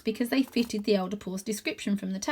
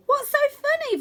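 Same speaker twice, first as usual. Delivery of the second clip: high, louder demand; 5 dB louder than usual. A narrator's reading. Cat demanding why Holly is giggling, high. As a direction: insist on an answer. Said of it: "Varjak demanded".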